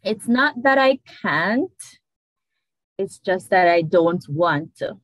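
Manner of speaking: slow, deliberate English sentence; English version. Shown as a demonstration. Giving a sentence to translate, slow. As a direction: read the line slowly for translating. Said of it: "This is the English version".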